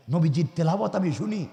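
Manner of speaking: ordinary talk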